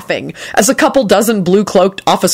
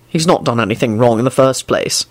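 low, detached statement; impassive; 5 semitones lower than usual. A narrator's reading. Quentin defending his friend to Elizabeth, impassive. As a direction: keep it low and unmoved. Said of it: "Quentin said impassively".

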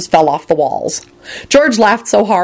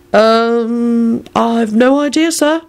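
high, hesitant, rising nervous reply; 6 semitones higher, much slower than usual. A narrator's reading. Charlie answering Shacklebolt, nervous. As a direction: stall on the um, then rise uneasily. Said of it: "Charlie replied nervously".

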